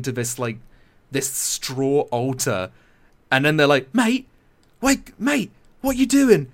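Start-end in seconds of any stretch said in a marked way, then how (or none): 3.9-6.4 high, urgent acted calling; mock-panicked British lad; alarmed lad. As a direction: call out to a mate in alarm. Acting alarmed lads in film retelling.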